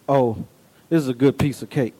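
low, quiet, unimpressed remark; unenthused. Shown as a demonstration. Preacher showing a congregation weak praise of cake, unenthused. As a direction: understate it, low and quick with no emphasis. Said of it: "But if I said".

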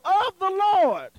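"of the Lord" high, loud and drawn out; stress on "of the Lord". Shown as a demonstration. Preacher driving home where joy comes from, stressing "of the Lord". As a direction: proclaim "of the Lord" slowly at full voice. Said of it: "you have to put of the Lord".

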